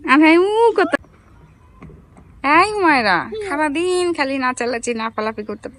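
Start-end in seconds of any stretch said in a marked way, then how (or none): none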